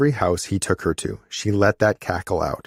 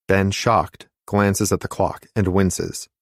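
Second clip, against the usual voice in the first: ordinary delivery, nothing marked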